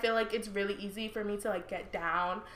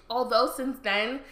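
ordinary talk

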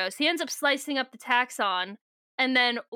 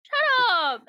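much higher, louder mock-indignant cry; playful shut up; 11 semitones higher, 4 dB louder than usual. Real, not acted. Co-host teases her about the book, she yells back playfully. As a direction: shout it back at a teasing friend.

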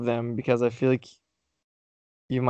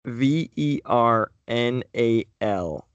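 spelling slowly; 2 semitones lower, much slower than usual. Shown as a demonstration. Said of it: "spelling out word".

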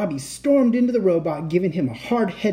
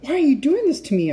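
high-pitched distressed plea; high, protesting wail; distressed cry; 11 semitones higher, 4 dB louder than usual. A narrator's reading. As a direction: cry it out, pleading. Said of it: "I cried".

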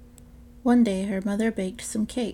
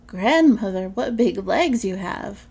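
put-on slow, louder, amazed child; the girl gasps at the wolf in granny's bed, slow and louder; wide-eyed wonder; 7 dB louder, slower than usual. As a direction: marvel at each strange feature.

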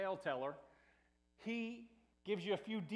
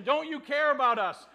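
loud, higher-pitched challenge; accusing question; 6 semitones higher, 12 dB louder than usual. Acted. Disciples to their sleeping teacher in the storm, accusing. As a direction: demand an answer, indignant. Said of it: "they make this accusation".

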